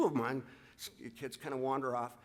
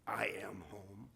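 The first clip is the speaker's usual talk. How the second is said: slow, softer, nearly a whisper; voice breaking; 6 semitones lower, 5 dB quieter, slower than usual. Real, not acted. Coach reading Jim's reply to his mom, voice breaking. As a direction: choke on the words as they come. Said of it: "I'm emotional".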